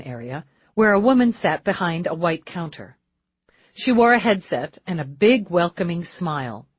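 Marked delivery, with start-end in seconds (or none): none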